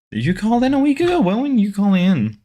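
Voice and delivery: high, fast, eager asking; excited question. Real, not acted. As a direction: blurt it out, surprised.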